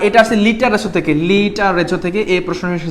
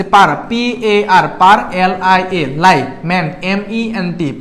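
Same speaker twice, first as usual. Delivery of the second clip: slow spelling; slower than usual. Shown as a demonstration. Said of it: "ভেঙে ভেঙে পড়তেছে".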